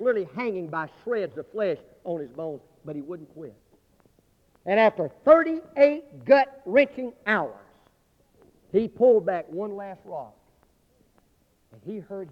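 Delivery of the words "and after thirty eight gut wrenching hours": loud, higher and very slow; slow dramatic build. Acted. Preacher slows for the climax, loud and drawn out. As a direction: stretch every word for drama.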